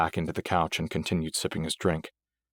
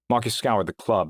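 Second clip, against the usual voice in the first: ordinary delivery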